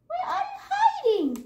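high playful call; high sing-song calling out. Acted. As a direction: call out to hidden cats. Calling out to cats.

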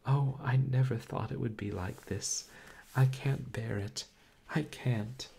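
high, loud tearful wail; sobbing. A narrator's reading. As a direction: break down in tears. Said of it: "she sobbed".

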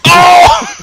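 very high, loud oh; startled shout. Real, not acted. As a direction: cry out in shock. Sudden event in game.

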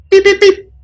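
high electronic beep-beep-beep. A narrator's reading. Narrator mimics the dropped scanner, beeping high.

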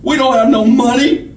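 high, scared character voice; high as a frightened schoolboy. A narrator's reading. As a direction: plead high like a frightened boy. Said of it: "sounded as high as a frightened schoolboy's".

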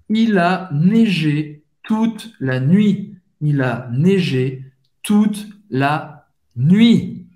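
slow, word by word for learners; careful slow dictation of French; slow model pronunciation. Shown as a demonstration. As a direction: spread the French phrase out with clear gaps. Teacher models phrase for learners.

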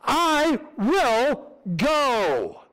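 lifted, slow and stirred, almost breaking; fervent cry. Shown as a demonstration. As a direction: cry it out like a pledge from the heart. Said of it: "Will we say that?".

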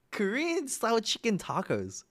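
high, slow and incredulous; baffled. Real, not acted. Streamer puzzling over a menu dish, baffled. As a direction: repeat it slowly, high-pitched with disbelief.

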